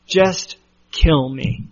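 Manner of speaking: slow, sulky, deadpan plea; upset and sulky. Retold or imitated. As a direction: drag it out like a sulking man. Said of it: "That's how upset he is".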